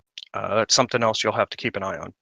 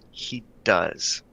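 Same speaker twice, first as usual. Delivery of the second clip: whispered, low aside; smirking undertone; 5 semitones lower, 5 dB quieter than usual. Acted. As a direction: drop to a whisper through a smirk.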